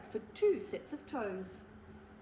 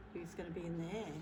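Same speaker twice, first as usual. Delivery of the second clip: plain reading voice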